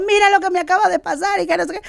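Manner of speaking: high, breathless-with-news retelling; like a madwoman. Retold or imitated. Wife telling husband of a miracle, frantic excitement. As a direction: blurt out astonishing news, pitched high. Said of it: "yo parecía una loca contándole".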